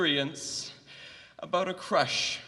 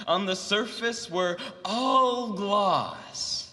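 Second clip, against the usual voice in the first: slam poetry; 4 semitones higher than usual. Acted. Student drops a visceral poem about a TA crush, slam poetry. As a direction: declaim it like an intense stage poet.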